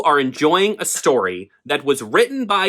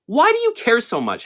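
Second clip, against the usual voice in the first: much higher, heated repeat of the question; exasperated; 9 semitones higher than usual. Real, not acted. Asking audiobook critics again, higher and exasperated. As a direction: throw the question back, fed up. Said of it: "here we are, once again".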